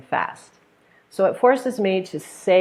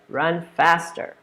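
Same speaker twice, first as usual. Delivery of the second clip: slow, definite "Run faster"; drawn-out command, no lift on "faster"; falling order tone; slower than usual. Shown as a demonstration. Coach ordering not asking, falling tone on "faster". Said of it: "He said".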